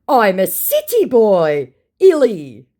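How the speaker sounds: slow, high, loud brag; mock boastful scammer. Scammer proud of being a city boy, mock boastful. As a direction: swell the boast in a put-on voice.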